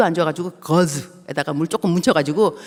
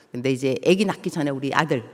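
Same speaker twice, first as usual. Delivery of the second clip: usual speaking voice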